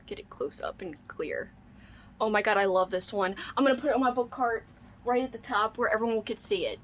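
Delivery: ordinary talk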